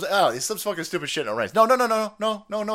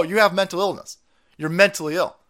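ordinary talk, nothing marked

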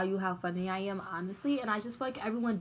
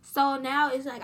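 ordinary delivery

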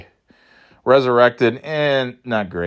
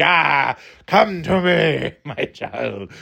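creepy devil beckoning; 3 semitones higher, much slower than usual. Acted. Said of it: "channeling the devil or something".

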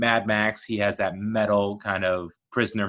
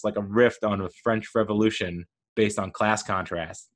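usual speaking voice

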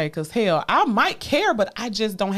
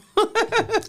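high bursting laugh; high burst of laughter. Real, not acted. Laughing at herself over being emotional. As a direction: laughs at her own confession.

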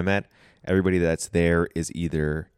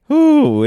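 high drawn-out ooh; excited ooh; 12 dB louder than usual. Real, not acted. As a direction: let out a big ooh. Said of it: "What was that first sound you said?".

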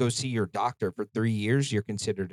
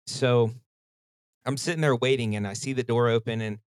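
ordinary talk, nothing marked

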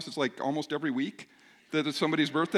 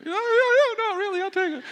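much higher, louder, overdone fake eagerness; voice goes up; 6 dB louder than usual. Pastor pretending he wants the caramel donut, squeaky fake eagerness. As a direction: insist too brightly that you're happy with it. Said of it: "when the voice goes up a little bit".

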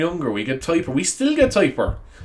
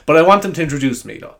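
usual speaking voice

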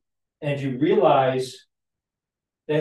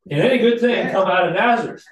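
higher, louder sneering disbelief; scoffing Nathanael; 6 semitones higher, 7 dB louder than usual. Retold or imitated. Nathanael sneering at the Messiah's hometown, loud and high. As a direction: scoff at the very idea of Nazareth.

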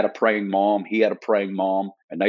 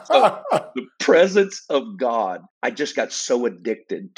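laughing; 3 semitones higher than usual. Real, not acted. Laughing while speaking.

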